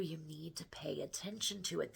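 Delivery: confiding whisper; urging viewers in a hushed whisper. Real, not acted. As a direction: lean in and whisper the advice. Stressing a point to viewers.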